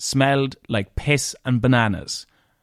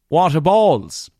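higher, louder, drawn-out dismay; exasperated; 5 semitones higher, 5 dB louder, slower than usual. Retold or imitated. Wedding falls on festival Saturday, exasperated. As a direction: let the exasperation out slowly and loudly.

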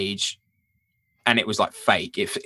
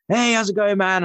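lightly voiced rock star greeting, pitched higher; a breezy, pitched-up hello played as an impression; imitating a friendly greeting; 11 semitones higher than usual. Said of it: "and he's like".

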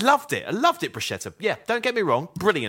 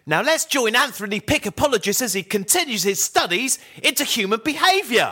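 high, lively announcer delivery; energetic read; 8 semitones higher than usual. Shown as a demonstration. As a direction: sell the line with bright energy. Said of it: "something like".

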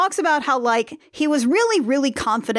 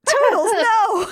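high, drawn-out playful wail; mock dismay; 9 semitones higher, 3 dB louder, slower than usual. Co-host lamenting unlucky Toodles, high mock dismay. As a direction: wail slowly in mock anguish.

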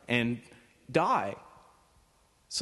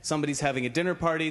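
ordinary talk, nothing marked

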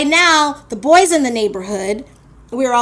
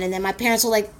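normal talking voice

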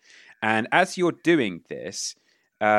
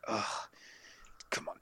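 moaning; 16 dB quieter than usual. A narrator's reading. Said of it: "moaning a little bit".

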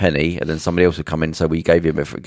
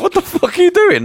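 much higher, louder incredulous cry; outraged disbelief; 5 dB louder than usual. Retold or imitated. Parents outraged at the crew taking the food back, shrieking. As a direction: shriek it out, appalled. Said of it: "the parents are like".

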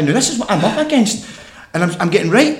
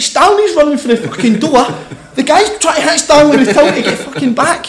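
higher, louder, laughing through the story; laughing while telling; 8 semitones higher, 6 dB louder than usual. Real, not acted. Telling the sauna chaos to the hosts, laughing. Retelling chaotic sauna scene.

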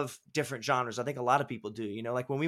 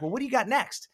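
higher, eager imitation of her; imitating an eager question about what is next; pumped-up imitation; 8 semitones higher than usual. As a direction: push for more like a fan who can't wait. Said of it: "She gets all pumped up".

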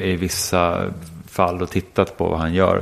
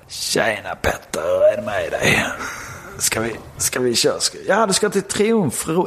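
långsam, utdragen skånsk imitation; försök härma hans skånska, långsamt; Martin Persson; slower than usual. Shown as a demonstration. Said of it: "Martin Perssons skånska då". (trevande härmad skånska)

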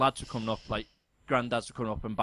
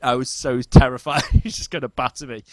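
laughing; 3 semitones higher, 9 dB louder than usual. Real, not acted. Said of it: "so hard not to laugh".

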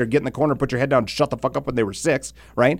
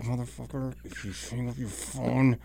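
muttered threat; 13 dB quieter than usual. Retold or imitated. Fuming at the new kid beside him, muttered threat. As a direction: hiss a threat under your breath. Seething threat under breath.

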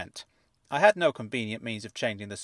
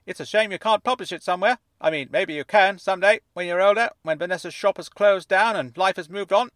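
high, fuzzy voice like it's underwater; fuzzy fish tank voice; 8 semitones higher, 7 dB louder than usual. A narrator's reading. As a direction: speak as if from inside a fish tank. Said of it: "as though he were speaking from the inside of a fish tank".

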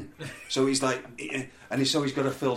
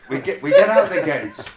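ordinary talk

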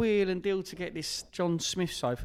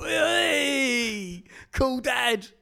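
teasing cool dad; 10 semitones higher, 6 dB louder, much slower than usual. Pretend. Mocking friend as festival dad.

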